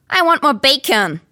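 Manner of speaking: put-on whiny demanding child. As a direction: whine it like a spoiled kid. Spoiled son demanding.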